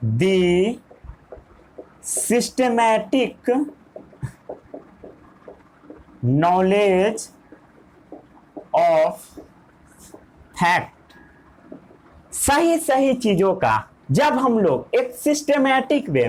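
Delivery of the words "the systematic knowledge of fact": each word drawn out slowly for note-taking; slow dictation. Shown as a demonstration. Teacher dictating to students, slow and deliberate. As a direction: spell it out word by word so they can write.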